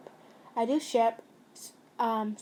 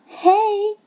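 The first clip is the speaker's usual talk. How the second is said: high, bright greeting on the phone; sweet hey; 10 semitones higher, 6 dB louder than usual. A narrator's reading. Best friend greeting the listener on the phone. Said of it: "you heard a sweet voice".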